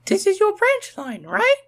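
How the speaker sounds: very high, bright eager voice; Rosie asking. A narrator's reading. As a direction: ask him hopefully, rising at the end. Rosie asks Thomas.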